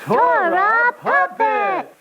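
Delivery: high, slow title call; show announcer. Acted. Announcing the show to the kids, high slow title call. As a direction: announce the show name with flourish.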